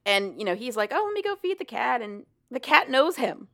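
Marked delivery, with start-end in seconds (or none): 0.9-2.0 breezy. Retold or imitated. Ghost casually offers to feed cat.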